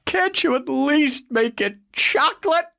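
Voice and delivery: lightly voiced whiny request; mock pleading citizen. A citizen begging for chocolate flavour, lightly whiny. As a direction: beg half-heartedly.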